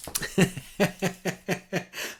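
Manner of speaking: real laughing. Real, not acted. Can't reveal the joke to viewers, helpless chuckling. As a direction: giggle away at a private joke. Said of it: "I'm laughing".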